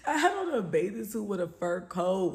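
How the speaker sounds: words breaking up with laughter; speaking while laughing. Real, not acted. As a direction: laugh through the line. Laughing at own outfit.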